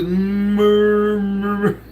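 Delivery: long drawn-out mumbled murmur; stretched, droning nonsense noise; puzzled sounding-out. Retold or imitated. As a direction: read the garbled word as one long drone. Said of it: "What the hell are you trying to say".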